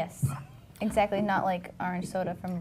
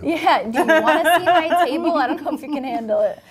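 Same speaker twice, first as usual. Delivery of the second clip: teasing laugh; 6 semitones higher, 10 dB louder than usual. Pretend. Teasing boast about her table.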